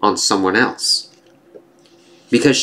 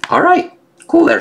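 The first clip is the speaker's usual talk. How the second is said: excited squeal; 4 dB louder than usual. Real, not acted. Cheering the hero on.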